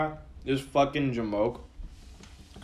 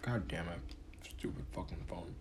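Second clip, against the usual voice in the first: real low, very soft swearing under the breath; muttered cursing; 6 semitones lower, 15 dB quieter than usual. Real, not acted. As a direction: curse at your phone, almost in a whisper.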